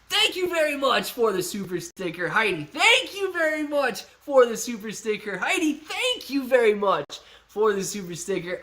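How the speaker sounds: high-pitched, over-the-top gratitude; hyped thanks. Real, not acted. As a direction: chant the thanks in a high, excited voice.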